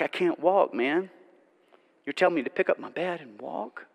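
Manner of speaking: quiet, halting disbelief turning to wonder; paralytic's stunned inner voice. Pretend. Paralytic stunned by the command, quiet and halting. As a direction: murmur it as if to yourself, amazed. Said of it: "What did he think? Like".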